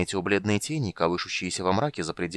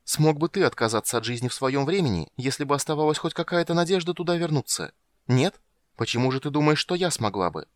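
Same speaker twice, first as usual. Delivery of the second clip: higher-pitched, indignant questioning; heated challenge; 7 semitones higher, 3 dB louder than usual. A narrator's reading. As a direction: press the argument with mounting bitterness. Heroine argues bitterly with companion. (с горячим вызовом)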